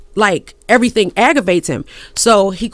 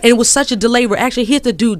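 normal talking voice

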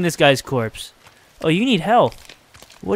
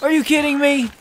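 outraged disbelief; 10 semitones higher than usual. Real, not acted. Outraged by the death in game.

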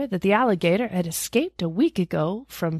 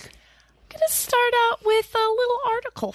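pitched way up, mock presenter; high announcer voice; 13 semitones higher than usual. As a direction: announce it high like a show host. Starting the newspaper reading.

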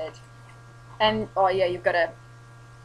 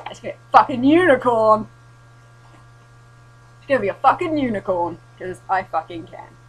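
defiant; 3 semitones higher, 10 dB louder than usual. Real, not acted. Defiant unicorn declaration.

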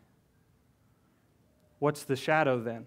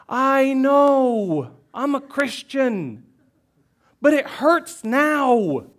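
higher, louder, slow and aching; pained outburst; 11 semitones higher, 8 dB louder, much slower than usual. Real, not acted. Pastor grieving his dad, pained and raised. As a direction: let the real hurt burst out. Pastor's grief breaks through.

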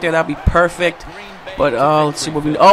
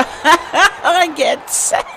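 high, slow words through laughter; high, drawn-out laughing complaint; laughing disbelief; 8 semitones higher, much slower than usual. Real, not acted. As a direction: crack up while saying it. Laughs at being sacked.

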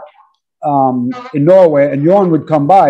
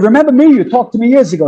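a keen, raised-voice reminder to a star who forgot; excited; 6 semitones higher, faster than usual. Retold or imitated. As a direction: jump in eagerly like an old friend calling back. Excitedly greeting [name] on call.

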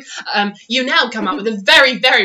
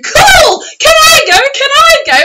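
very high, loud, eager squeals; children pleading to their parents, high and excited; excited kids; 10 dB louder than usual. Retold or imitated. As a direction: beg like a thrilled little kid. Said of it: "The little kids jumping up and down going".

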